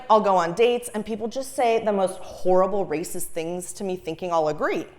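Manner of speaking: ordinary delivery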